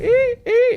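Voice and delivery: two very high, piercing van beeps; imitated reversing beep. Storyteller imitating his van reversing, high beeping. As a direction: squeal like a backing-up alarm. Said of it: "my van has that beep sound".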